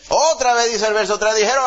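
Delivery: loud, high proclaiming of the verse; full-force preaching. Acted. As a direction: proclaim it to the congregation. Preaching the scripture aloud. (predicación a todo pulmón)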